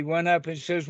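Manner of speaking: usual speaking voice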